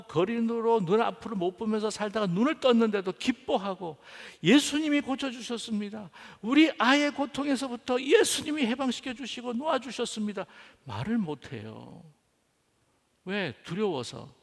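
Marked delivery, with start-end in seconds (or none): 4.4-10.5 high, elated proclamation; joyful testimony. Acted. Parents testifying that Jesus healed their child, joyful. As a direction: declare it with raised, overjoyed voice.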